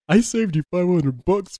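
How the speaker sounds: teasing imitation of a grovelling request; mocking echo. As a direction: slowly parrot the plea back in a put-on voice. Cohost mockingly quotes the letter back.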